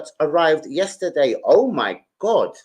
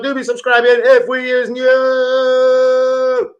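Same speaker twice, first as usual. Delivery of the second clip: catchphrase voice; 9 semitones higher, 6 dB louder, much slower than usual. Acted. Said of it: "stream sign-off catchphrase".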